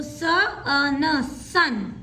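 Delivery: "स, अ, न, सन" said very slowly then joined; धीरे-धीरे जोड़कर; slow blending. Shown as a demonstration. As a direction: stretch each sound of "सन" then blend. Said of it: "sounds देखिए".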